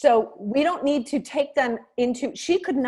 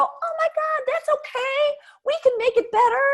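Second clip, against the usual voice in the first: much higher, gushing mock cheer; chipper and cheery. Shown as a demonstration. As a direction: overdo the bubbly reassurance. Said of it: "you don't wanna come to every call all chipper and cheery".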